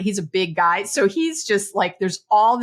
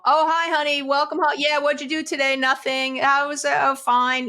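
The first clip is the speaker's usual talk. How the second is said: higher, breezy sing-song small talk; mock routine greeting; 5 semitones higher than usual. Couple trading hollow greetings at the door, mock sing-song. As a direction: parody a hollow hello. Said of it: "that conversation doesn't happen".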